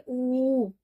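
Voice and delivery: ऊ held long and steady; drawn-out long vowel demo. Shown as a demonstration. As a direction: stretch the ऊ out. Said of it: "हम देर तक बोलेंगे".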